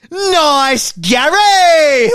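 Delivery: long, high, loud drawn-out yell; shouted prank. Retold or imitated. Shouting at a jogger from a passing car, long and loud. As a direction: bellow it from the car window.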